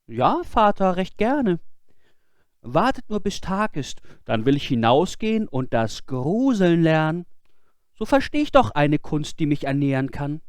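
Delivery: put-on high, earnest boyish voice; boy's voice. Boy explaining himself to his scolding father, high and earnest. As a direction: plead innocence.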